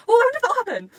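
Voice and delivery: high, loud burst of excitement; excited. Real, not acted. Fan hyped by their own theory, excited.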